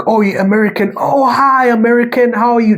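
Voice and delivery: high, loud over-eager hello to a foreigner; gushing mock greeting. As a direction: fawn over the American tourist, hammed-up. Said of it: "they didn't have to be like".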